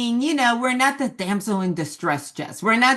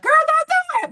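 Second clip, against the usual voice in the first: put-on very high, loud "girl, don't do it"; mock warning shout; 7 dB louder than usual. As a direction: yell at the screen like the fans. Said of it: "we're gonna be like".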